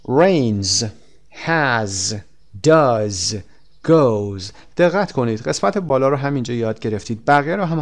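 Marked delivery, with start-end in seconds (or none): 0.1-4.4 "rains", "has", "does", "goes", each slowly ending in a voiced Z; final Z sound. Shown as a demonstration. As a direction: separate model words with a buzzing Z at the end. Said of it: "اما تلفظش".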